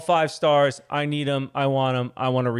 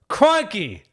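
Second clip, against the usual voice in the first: a loud, high Australian Crikey!; Australian accent; 11 semitones higher, 6 dB louder than usual. Pretend. Husband slips into an Australian accent, loud and high. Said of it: "That's Australian".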